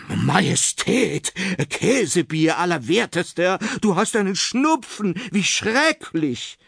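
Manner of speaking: high, panicky royal physician; high-pitched, dramatic alarm; horrified exclamation. A narrator's reading. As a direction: cry out aghast, pitch climbing. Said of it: "rief er erschrocken aus".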